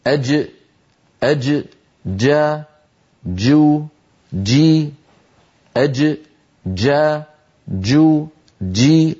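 أج جا جو جي with a stopped mid-tongue jim; classical jim drills. Shown as a demonstration. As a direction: drill the vowels on a firm jim. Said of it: "هكذا". (تدريب الجيم الفصيحة مع الحركات)

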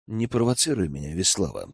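low-key, understated growl; growled warning. A narrator's reading. Elder warns the girl not to provoke him, restrained growl. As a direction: warn her quietly, with a touch of menace. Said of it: "прорычал я".

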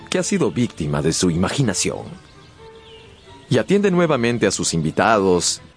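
ordinary talk, nothing marked

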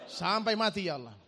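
loud, insistent retold plea; emphatic prayer. Preacher retelling his prayer to stay steadfast, loud emphatic plea. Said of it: "Saya doa".

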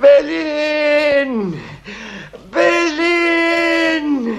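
long drawn-out call of the name. Acted. Ailing husband summoning his wife, drawn-out calling.

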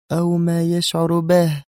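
slow, high, drawn-out jibe; sly insinuation. A narrator's reading. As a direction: stretch it out meaningfully. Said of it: "قالت جيهان بنفس الخبث". (بنفس الخبث)

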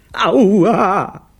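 long, high, loud "ow" wail; wailing in pain. A narrator's reading. Bitten director lamenting, loud high wail. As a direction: howl it out in pain. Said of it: "jammerte Brunelli".

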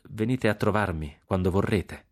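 plain reading voice

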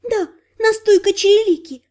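put-on high, chirping elf girl; chirpy elf. A narrator's reading. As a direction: chirp it, holding back laughter. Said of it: "быстро прощебетала эльфийка".

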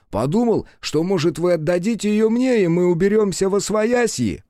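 high, eager servile bowing voice; fawning trickster voice. Acted. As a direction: grovel brightly while bowing. Fawning fat trickster apologising. (заискивающий голос)

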